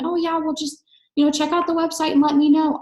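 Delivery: high-pitched mocking sales brush-off; airy "whatever" send-off, high-pitched and mocking; mock casual brush-off. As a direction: mimic a noncommittal seller, pitched well up. Mimics a weak sales brush-off.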